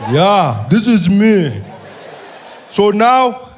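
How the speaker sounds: put-on slow, loud, heavy-accented; Malema impression. Malema greeting the comedian, slow and loud. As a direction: drag each word, teasing.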